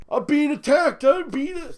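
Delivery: high, whiny imitation of a victim; sarcastic crybaby parody; mock whining. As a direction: play the crybaby, high-pitched. Mocking Nate's complaint.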